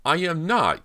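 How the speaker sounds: very high, loud indignant protest; shrill protest. Acted. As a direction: blurt it out, stung and embarrassed. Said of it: "Cody protested shrilly".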